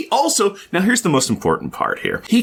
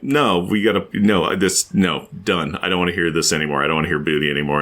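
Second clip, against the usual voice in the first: mock stern killjoy; 8 semitones lower than usual. Sue quoted as killjoy.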